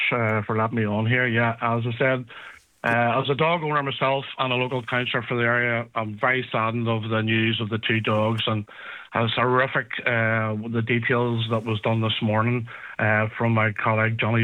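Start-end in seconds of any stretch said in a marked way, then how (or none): none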